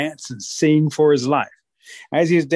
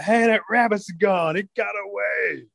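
higher, slow dismayed call; startled wolves; 6 semitones higher, slower than usual. Acted. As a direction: realise he fooled you. Wolves discover escape.

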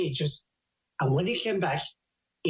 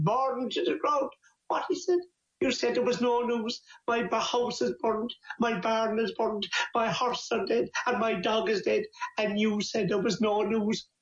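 higher-pitched, incredulous list of disasters; acted aghast disbelief; 4 semitones higher than usual. Man confronting neighbour over no news, acted disbelief. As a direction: keep it pitched up in mock dismay.

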